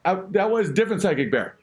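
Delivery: ordinary talk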